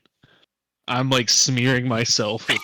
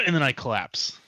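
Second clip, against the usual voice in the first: normal talking voice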